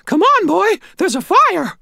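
loud, very high squeaky shout; urgent old lady. A narrator's reading. Old lady to hobbling Harry, urgent squeaky shout. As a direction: hurry him along in a squeaky old-woman voice.

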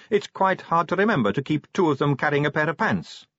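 put-on high, breezy young woman voice; chatty, light-hearted vampire girl. Sally voiced.

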